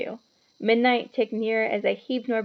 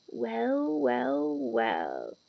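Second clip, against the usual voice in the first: whispering Filch; 4 dB quieter, much slower than usual. A narrator's reading. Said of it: "he whispered".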